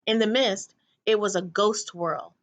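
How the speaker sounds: ordinary delivery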